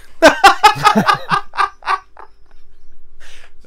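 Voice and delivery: high, loud burst of laughter; cracking up helplessly; loud laughing fit. Real, not acted. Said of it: "I can't stop laughing".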